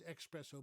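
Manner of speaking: "espresso" as ex-PRESS-o; "espresso" with a wrong x sound; mispronounced espresso. Retold or imitated. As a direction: start "espresso" like "express". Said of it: "Many people think it's".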